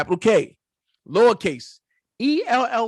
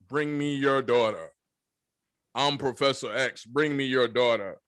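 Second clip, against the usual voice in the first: low, soft, commanding mind-control voice; menacing Professor X; 11 semitones lower, 5 dB quieter than usual. Retold or imitated. Robert ordering the father, low hypnotic command.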